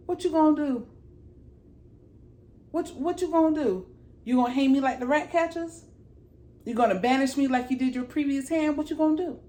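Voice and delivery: high, taunting questions; defiant challenge. Retold or imitated. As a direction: dare him to follow through. Acted mother dares her son.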